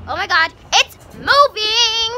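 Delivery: loud, high and slow frightened scream; screaming at a lizard. Real, not acted. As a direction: shriek it slowly, really scared. Lizard moves again.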